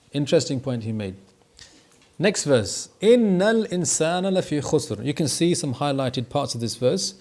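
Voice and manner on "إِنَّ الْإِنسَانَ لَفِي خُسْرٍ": Quran recitation. Acted. Reading the verse aloud in class.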